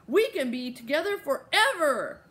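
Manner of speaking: put-on high, loud child's delight; joyful exclamation. A narrator's reading. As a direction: proclaim it with glee. Child hugging friend, sharing joyful news.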